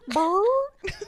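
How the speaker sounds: loud, high burst of breath noise; weird breath noise. Retold or imitated. Cohost imitating the talk-show host's noises, weird loud breath noise. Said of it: "it'll be, like, a weird, like, breath".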